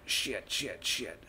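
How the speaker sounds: usual speaking voice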